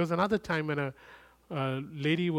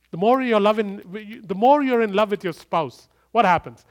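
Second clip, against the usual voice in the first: loud, higher preaching swell; preaching climax; 6 semitones higher, 10 dB louder than usual. Acted. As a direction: build to a full-force climax. Sermon climax.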